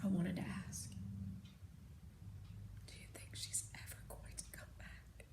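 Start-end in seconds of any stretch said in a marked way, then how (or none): 2.9-5.2 soft unvoiced whisper. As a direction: ask it under your breath. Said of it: "I whispered".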